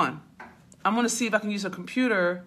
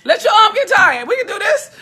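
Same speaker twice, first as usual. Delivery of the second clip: much higher and louder, squeezed through laughter; laughing mock quote; 12 semitones higher, 9 dB louder than usual. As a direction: quote them while cracking up. Mocking viewers' reply, laughing hard.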